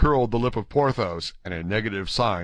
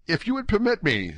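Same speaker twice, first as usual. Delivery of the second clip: high-pitched, mildly timid request; a touch of timidity, voiced high; politely tentative young Gascon; 11 semitones higher than usual. A narrator's reading. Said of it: "with timidity".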